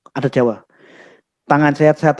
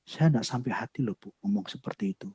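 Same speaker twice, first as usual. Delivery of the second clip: near whisper, far softer; soft and gentle; 6 semitones lower, 16 dB quieter than usual. Shown as a demonstration. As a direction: say it tenderly, almost breathless. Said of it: "tapi waktu itu saya ngomongnya lembut".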